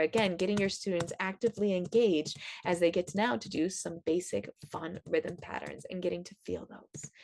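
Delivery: usual speaking voice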